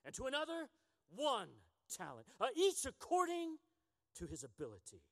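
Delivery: dramatic scripture reading with big swings in pitch and volume; passionate reading. Acted. Preacher reading the parable to his congregation, passionate. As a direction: read the Bible with some passion. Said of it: "I gotta read the Bible like that".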